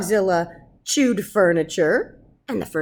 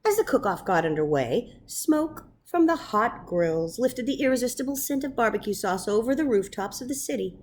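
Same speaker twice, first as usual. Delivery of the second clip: ordinary delivery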